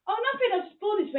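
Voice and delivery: high, quick and loud; imitated high-pitched cheery answer; breezy acted reply. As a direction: brush it off in a high, hurried voice. Boyfriend's reply to dad, acted.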